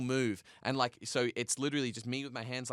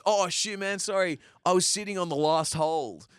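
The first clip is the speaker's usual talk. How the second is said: high, loud, breezy sorry; oblivious apology; 7 semitones higher, 4 dB louder than usual. Retold or imitated. Partner blocking climber's last hold, imitated cheery apology. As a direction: imitate a friend's sudden sheepish realization.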